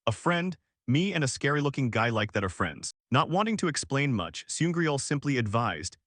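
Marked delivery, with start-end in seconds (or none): none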